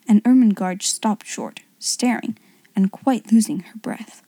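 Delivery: ordinary delivery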